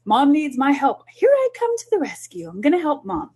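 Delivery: put-on high eager kid voice; proud child coming to the rescue, voiced high. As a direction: play the little hero rushing in. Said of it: "They love to feel like".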